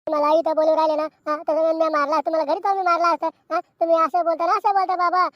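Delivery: heated, full-voiced outburst; indignant shouting. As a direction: shout back at your scolding father. Scolded boy argues back at father.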